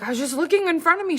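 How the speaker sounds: hurried, high-pitched retelling of her younger self; flustered teen. Retold or imitated. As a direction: blurt it out like a nervous student driver. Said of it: "And I was like".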